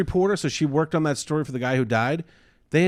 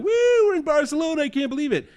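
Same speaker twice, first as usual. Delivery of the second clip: high, fast excited woo from the friends; mock excited squeal; 13 semitones higher, faster than usual. Friends filming the trip to Barcelona, squealing with high excitement.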